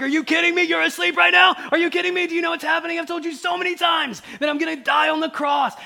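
high, loud exasperated shouting; frustrated outburst. Acted. Jesus scolding his sleeping disciples, frustrated outburst. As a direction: shout it in acted disbelief, rapid and relentless. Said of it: "Jesus is frustrated in this passage with the disciples".

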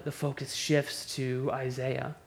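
usual speaking voice

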